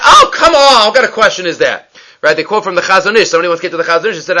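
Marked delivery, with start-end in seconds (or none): 0.0-1.9 loud, high, scoffing outburst; acted incredulous dismissal, starting high and loud; mock dismissive. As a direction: brush the question off with exaggerated exasperation. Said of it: "took her question seriously, right? Not".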